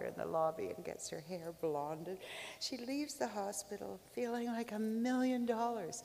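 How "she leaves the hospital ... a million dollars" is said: usual speaking voice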